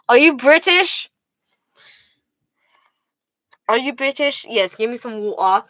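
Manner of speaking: British accent, acting out a chat. Shown as a demonstration. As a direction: ask it in a playful British accent. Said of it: "doing a British accent".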